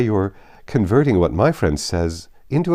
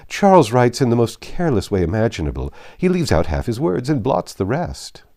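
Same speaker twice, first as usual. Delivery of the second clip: mocking sister; 4 semitones higher, 6 dB louder than usual. Miss Bingley mocking her brother.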